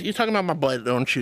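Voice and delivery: usual speaking voice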